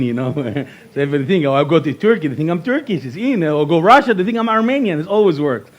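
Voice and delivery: normal talking voice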